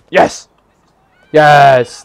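loud triumphant shout. Real, not acted. Gamer cheering a success, triumphant shout. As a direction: celebrate with a big drawn-out cheer.

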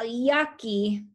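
usual speaking voice

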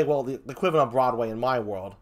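ordinary talk

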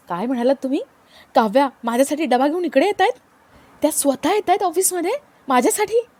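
can't believe his ears; stunned disbelief. A narrator's reading. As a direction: Parth thrilled to hear Kavya is coming, rising disbelief. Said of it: "पार्थचा स्वतःच्या कानांवरती विश्वास बसत नाही".

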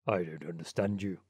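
put-on low old rat voice, lecturing; old water rat. Water rat lecturing the duck on friendship, low self-satisfied voice. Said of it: "answered the water rat".

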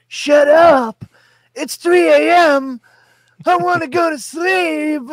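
hammed-up high-pitched shouting; yelled film impression. Dwayne in his bed at three A M, hammed-up yelling. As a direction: yell it way up high, overacting the outburst. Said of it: "it was an impression".

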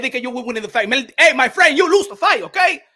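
indignant, loud correction; mock interviewer losing patience; confrontational interviewer. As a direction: cut him off and set him straight, loudly. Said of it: "I would've been like this".

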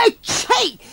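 high spoken "ecci" sneeze; high-pitched comic sneeze; acted sneeze. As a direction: burst into a storybook sneeze. Grandma with a cold sneezes.

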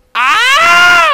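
very high, loud held cry; imitated piercing whoop; grandfather's yell. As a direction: holler like grandpa in the cantina. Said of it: "mi abuelo gritaba y decía".

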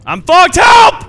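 high, loud cries for help; panicked screaming. Real, not acted. Being killed by a monster.

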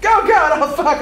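high loud startled cry; startled yell. Real, not acted. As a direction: jump at the scare again. Startled third time.

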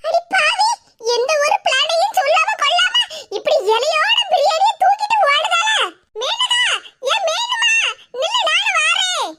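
high, indignant yelling after someone; outraged calling out. Acted. Woman chasing friend who took the biryani, outraged shout. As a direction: raise the pitch and call after a runaway.

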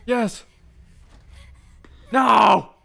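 high, loud yes then screamed no; joyful then despairing shout. Real, not acted. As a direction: cheer then howl. Win turned to loss.

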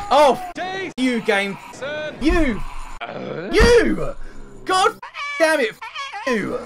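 high, shouted burst of fury; real gamer rage; raging at game. Real, not acted. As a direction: scream at the game, pitch climbing as it goes. Rage at game after dying.

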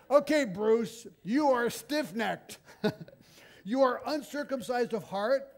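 loud, high, hammed-up rebuke; mock accusing sermon. Preacher mock-condemning his friend Bruce, hammed-up loud rebuke. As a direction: jab each charge at him, slow and loud. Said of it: "you end it this way".

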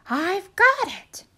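high, bright realisation; excited turkey voice. Acted. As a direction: seize the new idea.

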